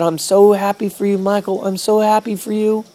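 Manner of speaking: high, tearful and moved; mother crying. Retold or imitated. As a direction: say it through tears of joy. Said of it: "she just began to cry".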